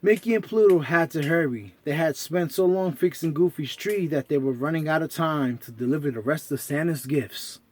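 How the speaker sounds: plain reading voice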